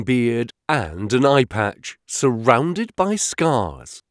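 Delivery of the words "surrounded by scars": ordinary delivery, nothing marked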